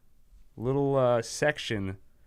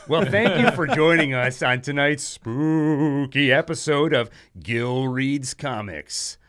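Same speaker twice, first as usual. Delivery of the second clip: spooky host sign-off; 4 semitones higher, 6 dB louder than usual. Acted.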